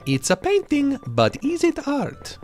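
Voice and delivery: high, slow Italian cartoon accent; over-the-top Italian accent, high and stretched; Mario impression. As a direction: ham up a bouncy Italian plumber voice. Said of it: "that's what Mario sounds like".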